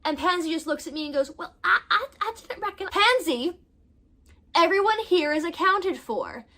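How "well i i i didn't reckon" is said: higher, flustered fake Southern accent. Pansy answers the maid of honor in a fake Southern accent. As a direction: caught out but clinging to the phony Southern accent. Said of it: "develops this fake Southern accent".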